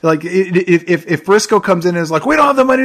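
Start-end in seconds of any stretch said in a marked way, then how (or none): 2.2-2.8 high whining objection, put on; imitated high-pitched protest; mock protest. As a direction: pitch it up like a fretful complaint. Voices Frisco's objection to Sharky.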